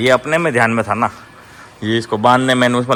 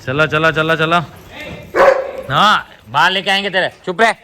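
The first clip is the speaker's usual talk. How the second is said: calling the dogs; 6 semitones higher than usual. Real, not acted.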